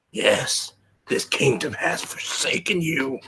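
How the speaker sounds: hushed conspiratorial whisper. Acted. Voice replies in whisper.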